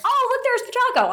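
squealing high with delight at spotting Pachaco; high, thrilled gush, like a fan spotting a mascot; excited squeal. Retold or imitated. Voice actor spots her own costumed character, squealing high.

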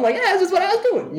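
very high, breezy showman. Retold or imitated. Joking as [name] about inventing rock, very high. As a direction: shrug it off way up high.

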